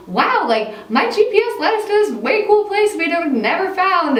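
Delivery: high, amazed retold gush; excited wow. To friends, retelling her thrill at the street art, excited. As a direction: gush over the find in awe.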